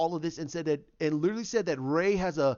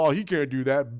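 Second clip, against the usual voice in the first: mock doubter; 4 semitones lower, 4 dB louder than usual. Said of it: "You can't go".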